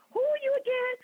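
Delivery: very high incredulous question; high, baffled what-now; retold disbelief. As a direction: squeak it out in total confusion. Baffled by mystery caller.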